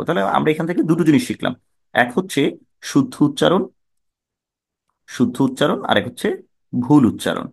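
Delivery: usual speaking voice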